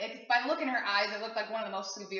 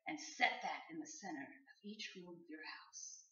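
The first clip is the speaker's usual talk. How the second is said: soft, hushed mock ritual instructions; psychic giving instructions; 5 semitones lower, 13 dB quieter than usual. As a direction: imitate a psychic, fading toward a whisper. Voices the psychic's solemn instructions.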